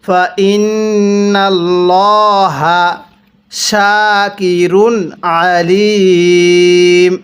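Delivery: slow, drawn-out tajweed chanting; slow Quran recitation. Shown as a demonstration. Teacher demonstrating recitation to students, slow chant. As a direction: recite each syllable long and measured. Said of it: "এরকমভাবে শিখব".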